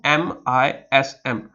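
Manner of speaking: letters यू पी एच ई एम आई एस एम, each said apart, very slow; slow spelling out. Shown as a demonstration. Teacher spells the word for students, very slow. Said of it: "spellings बहुत easy हो जाते हैं".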